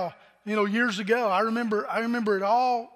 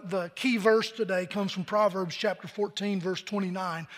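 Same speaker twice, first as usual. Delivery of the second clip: normal talking voice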